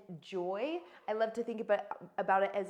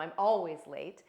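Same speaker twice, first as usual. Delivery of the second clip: ultimatum; 4 semitones lower, 5 dB louder than usual. Shown as a demonstration. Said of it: "like, an ultimatum".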